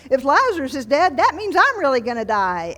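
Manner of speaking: hammed-up alarm with a drawn "Oh"; dawning alarm. Preacher voicing Jesus realizing he will die, mock alarm. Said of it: "And so he's crying because".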